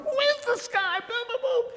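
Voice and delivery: high, shrieking freaked-out question; mock Julie freaking out at the stranger; panicked woman's voice. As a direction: squeal it like she's losing it.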